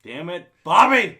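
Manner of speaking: loud exasperated yell on Bobby; cartoon dad catchphrase. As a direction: bark it like a fed-up cartoon father.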